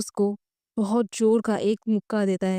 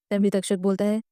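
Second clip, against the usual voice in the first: ordinary delivery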